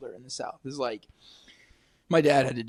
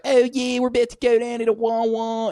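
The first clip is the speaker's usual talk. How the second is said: put-on Delco accent; 10 semitones higher, 7 dB louder than usual. Imitating trashy local women heading out, high loud Delco accent.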